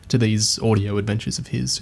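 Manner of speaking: normal talking voice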